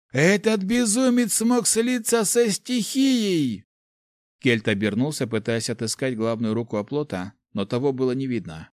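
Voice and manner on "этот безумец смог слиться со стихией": high, loud, slow alarmed shout; shouted warning. Acted. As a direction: yell the warning to your allies.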